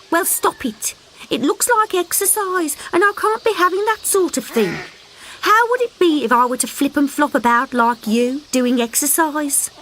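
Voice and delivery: high mother voice, mildly scolding; scolding mother frog. Acted. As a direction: tell the child off lazily.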